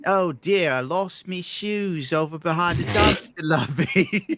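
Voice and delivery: high, hammed-up English damsel; drawn-out mock English helplessness; mock English accent. Plays English-accented lure, following accent joke.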